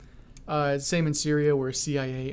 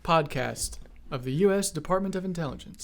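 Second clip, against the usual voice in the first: announcer intro; 4 semitones higher than usual. Acted. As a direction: announce the show grandly.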